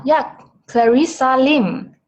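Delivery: normal talking voice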